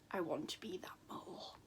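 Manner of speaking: quick playful whisper; whispered aside. Real, not acted. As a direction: confide it under your breath. Confessing aside about the mole.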